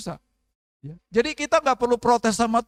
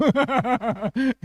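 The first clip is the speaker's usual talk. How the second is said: laughing; 3 semitones higher than usual. Real, not acted. Laughing at own joke.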